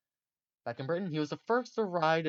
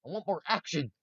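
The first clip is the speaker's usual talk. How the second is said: mock outburst; 6 semitones higher, 4 dB louder than usual. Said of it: "he's just like".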